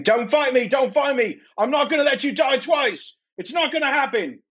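high, desperate in-character screaming. Acted. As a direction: scream it high, pleading through a struggle. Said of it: "he just keeps screaming".

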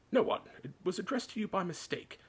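high, brusque brush-off; terse, irritated denial; short, curt. A narrator's reading. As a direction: dismiss the boy's question curtly. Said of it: "said Uncle Vernon shortly".

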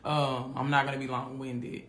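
usual speaking voice

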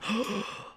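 high, hammed-up shocked gasp; imitating bystanders gasping in shock; mock gasp. Mimics shocked onlookers.

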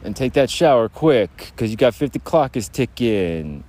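hammed-up shower enforcer calling out orders; bathroom bully. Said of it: "You have the bathroom bully".

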